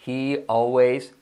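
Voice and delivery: "always wins" very slow, the I in "wins" like an E; slow drill. Shown as a demonstration. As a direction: drag "He always wins" slowly. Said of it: "que suene casi como una E". ("wins" casi como "wens", lento)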